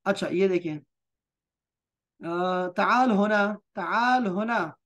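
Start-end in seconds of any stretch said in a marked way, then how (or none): 2.7-4.8 slow "ताल होना" with stretched aa; model pronunciation. Shown as a demonstration. Teacher showing how it will be said, model pronunciation. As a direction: draw out "ताल होना" for learners. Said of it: "ऐसे ही बोलेंगे".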